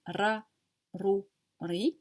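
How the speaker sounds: "ra" "rou" "ri" with a medium rolled r; lightly rolled r. Shown as a demonstration. Teacher shows a moderately rolled r. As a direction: roll the r a little, not too much. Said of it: "donc c'est un R roulé".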